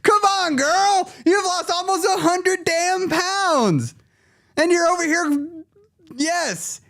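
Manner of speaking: very high, slow sassy scold; sassy disbelief. Real, not acted. Scolding a viewer who complains about three pounds, very high sassy voice.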